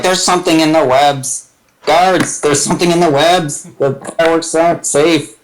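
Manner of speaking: high-pitched mock warning to the guards; urgent alert, acted without shouting; calling out in alarm. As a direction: raise the pitch and sound the alarm. Illusionist calls out alarm to guards.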